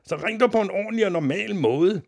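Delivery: high, exasperated yelling; screamed protest. A narrator's reading. Father demands his son phone normally, yelling. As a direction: holler it as a frantic father. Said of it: "skreg faren".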